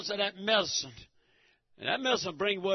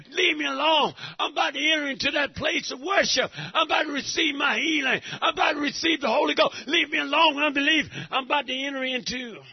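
raised, higher, louder praise shout; fervent church shout, reliving the moment; retold ecstatic praise; 4 semitones higher, 4 dB louder than usual. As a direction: shout it like a man caught up in worship. Retelling his ecstatic worship shout.

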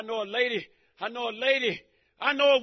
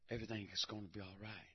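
reassuring; 13 semitones lower, 19 dB quieter, faster than usual. Retold or imitated. Soft reassurance to wife.

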